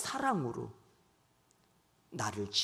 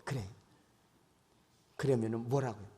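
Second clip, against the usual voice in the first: ordinary talk, nothing marked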